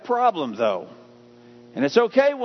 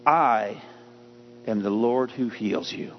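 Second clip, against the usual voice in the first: solemn voice of God; 6 semitones lower than usual. Acted.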